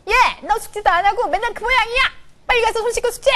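put-on high, loud nagging at the child; scolding mother. Mother nagging her child about homework, high and loud. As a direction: scold the kid to go wash and study.

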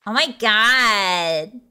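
put-on drawn-out Valley Girl exclamation; slow, stretched Valley Girl vowels. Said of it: "five minutes worth of Valley Girl accent".

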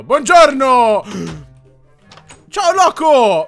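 loud, drawn-out hello; loud greeting. Real, not acted. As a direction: call out a big greeting. Greets new game character.

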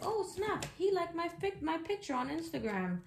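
high-pitched giddy gasp of discovery, sliding down; mock excitement. Tarot reader mocking someone excited over an Instagram like, high mock excitement. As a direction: imitate someone thrilled over a tiny sign of interest.

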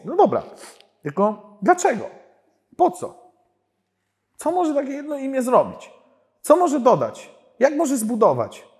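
high, loud, insistent questions; anxious inner questioning. Retold or imitated. Speaker replaying his own doubts before God, high and insistent. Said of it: "takie pytania miałem w sobie".

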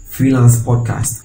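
ordinary delivery

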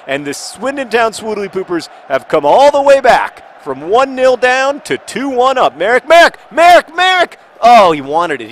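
high, loud match commentary building to shouts; excited commentary. Real, not acted. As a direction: shout the player's name as he charges. Commentates comeback and next attack.

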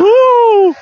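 very high, loud whoop; thrilled high yelp; excited whoop. Real, not acted. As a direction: whoop as it lifts off. Balloon takes off.

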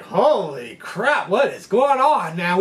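drawn-out, stunned exclamation; retold astonishment. Fishermen reacting to a ten dollar tip, astonished. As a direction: stretch the first words in mock amazement.

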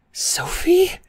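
high, breathy whispered name. Acted. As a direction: whisper her name in disbelief. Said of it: "Josh whispered, elated".

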